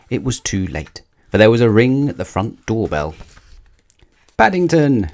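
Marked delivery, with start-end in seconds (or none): 4.4-5.1 high, loud call of his name; crying out. A narrator's reading. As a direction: call out to him in alarm. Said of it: "cried Missus Brown".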